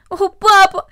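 high, loud sobbing plea; tearful pleading cry. A narrator's reading. Cornelli begs her father not to send her away, crying. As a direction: wail out to papa through tears. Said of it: "взмолилась она".

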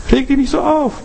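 a sudden high repeat. Shown as a demonstration. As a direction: lift the whole line up high, as a repeat. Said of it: "So, reg dich nicht so auf".

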